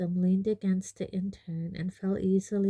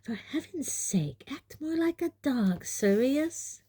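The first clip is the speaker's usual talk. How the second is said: hissing; 7 semitones higher than usual. A narrator's reading.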